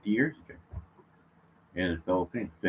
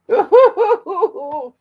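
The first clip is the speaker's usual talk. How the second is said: very high word breaking into a laugh; laughing squeal; 11 dB louder than usual. Real, not acted. Man squeals with laughter as the dog licks his toe. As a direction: squeal it as the tickle hits.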